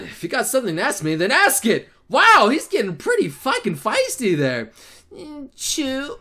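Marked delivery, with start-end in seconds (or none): 5.1-6.2 soft, timid little utterance; tiny voice. Acted. She asks one more thing, in a tiny voice. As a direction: say it very softly and small. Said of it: "she says in a tiny voice".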